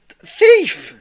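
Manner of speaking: high, loud cry of alarm; yell. A narrator's reading. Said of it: "he yelled".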